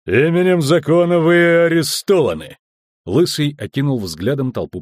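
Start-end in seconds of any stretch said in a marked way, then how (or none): 0.1-2.6 raised, higher-pitched command; official arrest cry. A narrator's reading. Guard arresting a passenger aboard, official and raised. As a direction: announce the arrest with authority.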